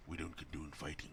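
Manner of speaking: whispered mock disclaimer; soft unvoiced whisper. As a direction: hush it like a secret aside. Mock disclaimer after fight.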